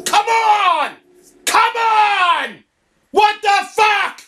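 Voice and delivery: real gamer rage yells; frustrated shouting. Real, not acted. Game keeps killing him.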